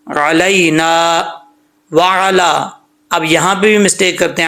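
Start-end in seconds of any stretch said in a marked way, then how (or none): 0.1-1.2 slow अलैना with the final ना held long; lengthened alif. Shown as a demonstration. Teacher shows the alif length, careful tajweed. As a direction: stretch the end of अलैना. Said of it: "अलिफ लंबा करेंगे".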